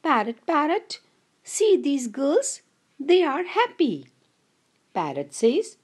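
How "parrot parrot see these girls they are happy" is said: high, light little-bird voice for the sparrow. Acted. Teacher voicing the sparrow for her class, high bird voice. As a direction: pitch it up like a chirpy small bird telling a friend. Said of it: "Sparrow says".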